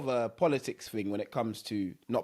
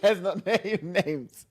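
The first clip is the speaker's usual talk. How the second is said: high, loud laughing no no; laughing protest; 8 semitones higher, 6 dB louder, slower than usual. Real, not acted. Laughing as he pleads with his friend not to name names. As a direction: laugh while begging him to stop.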